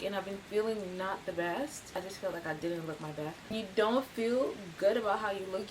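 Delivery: ordinary talk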